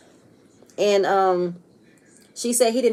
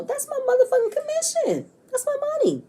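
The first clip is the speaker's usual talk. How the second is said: squeaky high mock voice, much higher; shrill parody of an angry realtor; mock high-pitched rant. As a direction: squeal it out like a cartoon version of her. Retelling Robin's angry rant.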